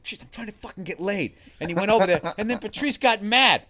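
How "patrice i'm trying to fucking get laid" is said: much softer pleading aside; hushed plea. Retold or imitated. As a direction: keep it down so the date won't hear.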